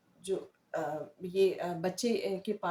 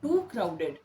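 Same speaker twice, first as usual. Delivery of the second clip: sarcastic remark; much slower than usual. Shown as a demonstration. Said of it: "जाके बोलते हैं".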